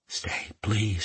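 silently mouthed, barely audible. Acted. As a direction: mouth the words with almost no voice. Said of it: "she mouthed".

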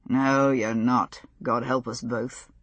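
slow, low and wry; lightly voiced weary gentleman. A narrator's reading. Lord John, low and slow.

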